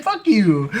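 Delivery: high, loud mock jab; mock coach taunt. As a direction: spit it out as a put-down. Voicing coach's trade as insult.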